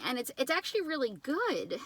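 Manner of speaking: normal talking voice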